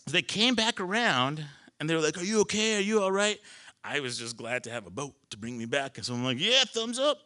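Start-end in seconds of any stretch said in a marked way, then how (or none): 2.2-3.4 high-pitched anxious check-in; imitated concerned boat crew. Boat crew circling back to swimmer, high mock concern. 6.4-7.2 pitched way up, mock okay; high-pitched cheery reply. Swimmer glad of a boat answering crew, high mock cheer.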